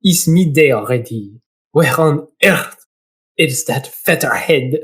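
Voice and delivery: put-on low-pitched, drawn-out French accent. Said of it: "He's gonna be French".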